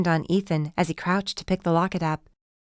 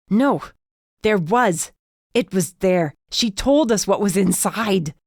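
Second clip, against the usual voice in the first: spat through gritted teeth; 3 semitones higher, 4 dB louder, slower than usual. A narrator's reading. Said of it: "the words spitting their way out".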